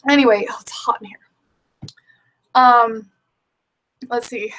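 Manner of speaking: talking between sips of a drink; while sipping a drink. Real, not acted. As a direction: speak just after a sip, half whispered. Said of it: "excuse me while I sip my dinner".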